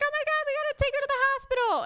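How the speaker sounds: high, fast mock panic; imitating frantic parents, rushed and high; panicked parents. Parents rushing grandma to hospital, mock panic. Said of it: "my parents were like".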